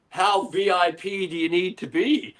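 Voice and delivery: lightly voiced, slow, dry comeback. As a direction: drawl it out like a star who can't be bothered.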